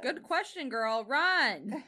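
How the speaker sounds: urgent shout to run; loud warning. Pretend. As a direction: yell at her to flee.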